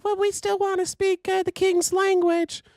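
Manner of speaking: very high falsetto mock voice; mock early Americans. Host mocking settlers who came to America, silly falsetto. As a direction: squeak it out, slowing on the royal phrase. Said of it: "and we're like".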